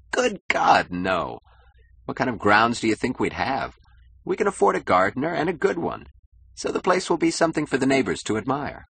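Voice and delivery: lightly voiced boastful husband, scornful. Husband scorning his wife's gardening question, mildly indignant and boastful. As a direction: lightly play a status-proud husband dismissing the idea.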